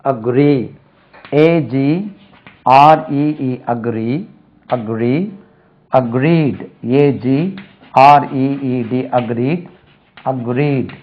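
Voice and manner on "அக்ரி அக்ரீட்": normal talking voice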